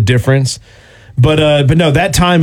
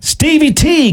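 high, bright call-out of a name; excited greeting; 13 semitones higher, slower than usual. Real, not acted. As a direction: sing out the name in welcome. Host greets listener excitedly.

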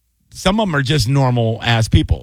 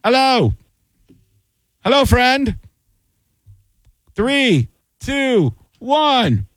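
announcer voice; 12 semitones higher, much slower than usual. Acted. Mock on-air countdown.

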